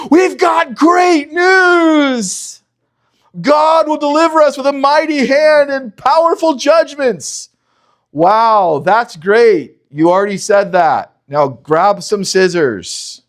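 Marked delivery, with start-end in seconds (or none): 0.1-7.5 much higher and louder, a slow shouted start; excited announcement. Acted. Moses and Aaron bring good news to the people, shouting with excitement. 8.1-11.2 higher and louder, mock enthusiasm; sarcastic reply. Weary Israelites answer Moses, mockingly unimpressed.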